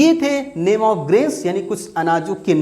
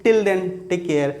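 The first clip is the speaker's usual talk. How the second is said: slow sign-off; much slower than usual. Real, not acted. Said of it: "video sign-off".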